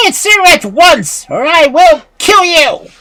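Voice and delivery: high, loud, furious threat; angry witch calling. A narrator's reading. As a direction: yell the threat as an enraged witch. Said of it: "calling angrily".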